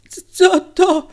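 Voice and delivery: high, loud, stammered bewilderment; dazed, startled asking; uncomprehending question. A narrator's reading. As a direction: stumble on the first sound, totally confused. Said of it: "pyta Kubok, nic nie rozumiejąc".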